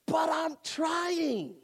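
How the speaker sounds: slow, high, stretched-out cry; drawn-out preacher's cry. Acted. Preacher to congregation, high drawn-out cry. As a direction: stretch it out, pleading hard.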